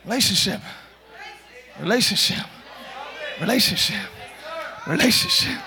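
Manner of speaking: low, soft, one word at a time; hushed repetition. Real, not acted. Preacher impressing relationship on congregation, hushed and low. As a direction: let the word sink in, quietly.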